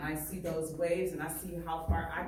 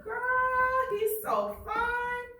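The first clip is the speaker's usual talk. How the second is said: put-on high, squealing, gushing girl voice, much louder; giggly girls; 6 dB louder, much slower than usual. Teacher voicing the giggling girls across the hall, high squeal. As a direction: gush like a smitten schoolgirl. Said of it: "Her friends will giggle and whisper".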